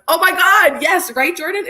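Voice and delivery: agreeing with Jordan about the bottle, high and eager; excited agreement. Real, not acted. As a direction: pounce on the news with delight.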